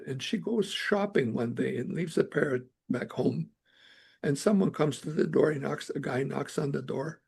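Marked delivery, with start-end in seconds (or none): none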